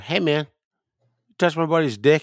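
acted-out indignant confrontation; confrontational callout. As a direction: call him out across the bar. Calls out to confront a stranger.

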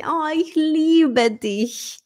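slow tender love line; tender declaration. Acted. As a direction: breathe it to a lover. Romantic declaration acted.

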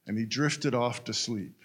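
ordinary delivery

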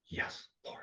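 breathy whispered surrender; whispered reply. Retold or imitated. He answers Jesus in a whisper. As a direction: barely voice it, a hushed yes.

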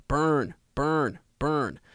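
high, falling cries, evenly repeated; raven's repeated call. A narrator's reading. Raven urging Jon with the lamp, insistent calling. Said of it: "the raven called".

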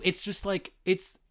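usual speaking voice

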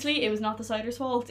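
ordinary talk

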